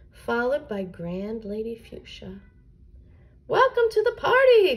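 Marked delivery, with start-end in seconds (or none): 3.5-4.8 high, loud chorus-like welcome; joyful cry. A narrator's reading. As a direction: call it out high and loud to the guests. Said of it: "cried the flowers".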